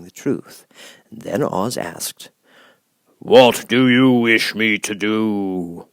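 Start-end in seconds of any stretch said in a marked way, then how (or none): 3.2-5.9 booming, much louder, very slow voice of the giant head; great and terrible Oz. Acted. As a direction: loom over her, slow and thundering. Oz asks.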